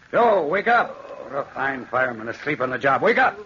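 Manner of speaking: wake-up shout; calling out. Acted. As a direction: rouse the dozing fireman. Waking sleeping fireman.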